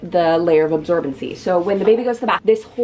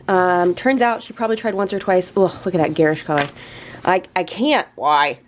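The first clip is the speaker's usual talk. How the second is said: ordinary talk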